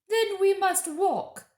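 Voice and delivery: high, loud, slow witch voice; cold witch decision. A narrator's reading. Witch deciding to walk, high, loud and cold. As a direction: pronounce it like a ruthless queen. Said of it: "said the witch".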